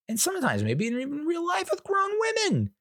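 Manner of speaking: very high, squeaky defensive concession; mock indignation. Dad defending himself over daughter's hair, in squeaky mock indignation. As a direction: climb into an exaggerated high pitch.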